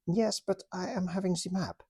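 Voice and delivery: put-on high, lightly accented stubborn officer; German toy officer defending his map, in a high stubborn voice. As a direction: insist on the map, pitched high. German toy soldier answers.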